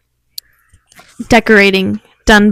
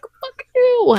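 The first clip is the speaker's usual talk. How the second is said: much higher, soft mock little-kid retort; bratty kid; 6 dB quieter than usual. Toddler talking back to her mom, high squeaky mock defiance. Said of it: "I was like".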